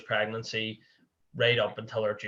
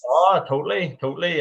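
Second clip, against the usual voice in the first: emphatic; 5 semitones higher, 6 dB louder than usual. Real, not acted. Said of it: "You can remember all that, can you?".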